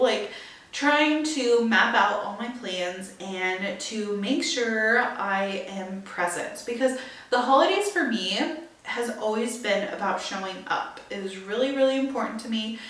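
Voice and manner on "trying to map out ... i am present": ordinary delivery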